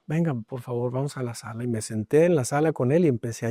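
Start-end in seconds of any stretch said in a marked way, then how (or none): 0.1-1.6 gentle father. Retold or imitated. Father gently invites son.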